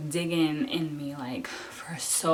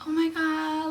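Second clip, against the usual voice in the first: very high-pitched amazement; retold high squeal of wonder; amazed exclamation; 11 semitones higher, 3 dB louder than usual. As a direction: exclaim in awe, pitched right up. Acting out amazed reaction after birth.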